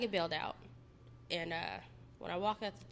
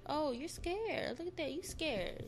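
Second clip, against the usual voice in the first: higher, mocking sneer at her fear; mocking taunt; 7 semitones higher, slower than usual. Abuser taunting his scared girlfriend, mock sneering. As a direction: taunt her like a bully, lightly voiced. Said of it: "He's like".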